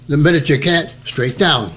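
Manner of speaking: normal talking voice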